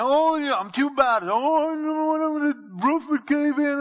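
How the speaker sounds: high, loud, slow whiny protest; mock excuse-maker. Sinner claiming to be too bad, high and hammy. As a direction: whine that you're too bad.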